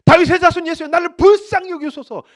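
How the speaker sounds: loud high desperate cry. Acted. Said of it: "더 크게 소리 지릅니다".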